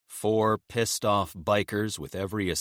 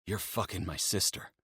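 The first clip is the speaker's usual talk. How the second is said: stunned, quiet; 7 dB quieter than usual. Acted. Emil stunned realisation.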